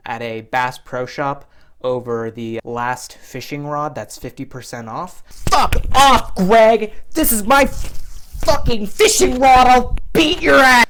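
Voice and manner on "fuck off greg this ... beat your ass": much higher and louder, threatening yell; screaming brawl threat; furious shout. Retold or imitated. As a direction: fisherman fighting over a discount rod. Imagined fistfight over fishing rod.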